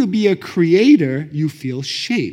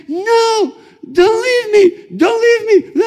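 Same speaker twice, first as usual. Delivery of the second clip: very high, loud wailing plea; hammed-up begging screech; desperate pleading; 7 dB louder, slower than usual. Girl to the man leaving her, hammed-up desperate wail. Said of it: "She's like".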